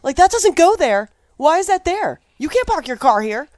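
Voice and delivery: high, loud mock-indignant voice; guy from Lost. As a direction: play a fussy guy scolding about parking.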